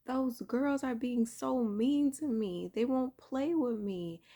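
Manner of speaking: put-on girl telling grandma she's bullied; sad little girl. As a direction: tell it like a hurt child. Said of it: "I just looked sadder and sadder".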